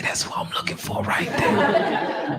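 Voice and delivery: slow, hushed aside; secretive whisper. Acted. New inmate finally spots Cece, whispering. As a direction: whisper to yourself on spotting your target.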